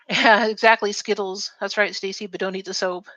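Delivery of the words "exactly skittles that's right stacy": ordinary delivery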